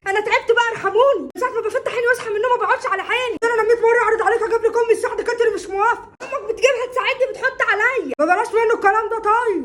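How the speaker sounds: high-pitched, quick exasperated tirade; worked-up rant. Acted. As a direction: let the fed-up complaint pour out. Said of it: "اهدي بس يا طنط".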